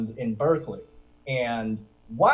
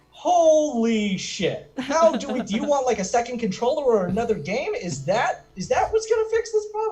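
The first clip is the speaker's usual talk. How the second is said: stunned dad; 8 semitones higher, 3 dB louder than usual. Acted. Shocked dad scrambling to fix his child's problem, flustered. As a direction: act out a thrown, high-pitched father.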